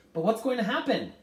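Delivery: high, loud frightened question; trembling. A narrator's reading. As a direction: ask in fearful urgency. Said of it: "Meg's voice trembled".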